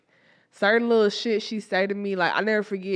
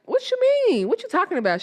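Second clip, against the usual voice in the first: shocked reply; 13 semitones higher, 5 dB louder, faster than usual. Retold or imitated. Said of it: "And I was like".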